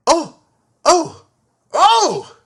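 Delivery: high loud startled oh oh oh; porters alarmed. A narrator's reading. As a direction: cry out in alarm as the trolley rolls. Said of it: "The porters went".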